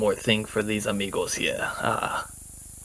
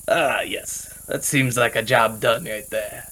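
put-on slow, satisfied, light accent; lightly voiced Spanish accent; Spanish-accented character; 3 dB quieter, slower than usual. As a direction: sound pleased with the work. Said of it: "and be like".

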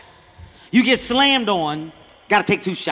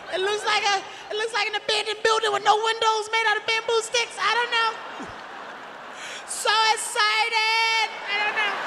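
high, softer, panicked rambling; high-pitched breathless confusion, near laughing; frantic falsetto; 11 semitones higher, 6 dB quieter than usual. Retold or imitated. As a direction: babble helplessly like a lost foreigner. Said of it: "I lost it".

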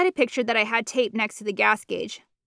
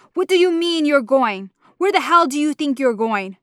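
sharp, louder, higher demand; angry mother; 4 semitones higher, 4 dB louder than usual. Acted. Mama angrily stopping her daughter at the door. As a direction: cut her off mid-escape.

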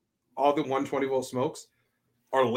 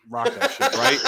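laughing; 12 semitones higher, 6 dB louder than usual. Real, not acted. Laughing mock encouragement.

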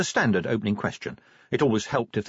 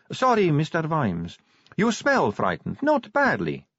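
high-pitched vampire recruit; Sally; 7 semitones higher than usual. A narrator's reading. Sally speaks to Vimes.

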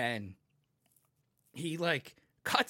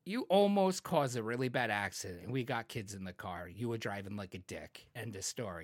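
calm firm quiet; 9 semitones lower, 7 dB quieter than usual. Retold or imitated. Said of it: "I was like".

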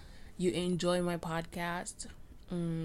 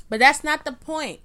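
louder, higher snap back on track; emphatic cut-off; 8 semitones higher, 9 dB louder than usual. Real, not acted. Podcaster reining herself in, emphatic. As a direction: stop the tangent firmly. Cutting herself off mid-rant.

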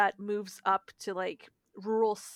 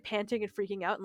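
normal talking voice

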